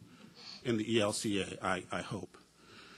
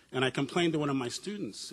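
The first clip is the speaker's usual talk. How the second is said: ordinary talk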